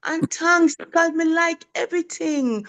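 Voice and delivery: put-on little boy voice. Teacher voicing her pupil's phone thanks. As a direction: lightly play the second grader. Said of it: "And he said, him say".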